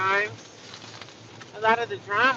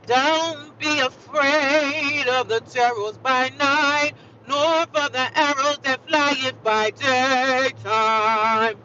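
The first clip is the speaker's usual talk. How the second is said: loud, high, very slow declamation; hammed-up, drawn-out proclamation; mock-grand scripture recital; 5 semitones higher, 6 dB louder, much slower than usual. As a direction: stretch each word like booming scripture.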